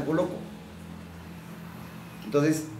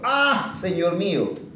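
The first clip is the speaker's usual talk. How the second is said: anguished cry; 4 semitones higher, much slower than usual. A narrator's reading. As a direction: cry out in alarm over the lost axe. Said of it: "y gritó diciendo".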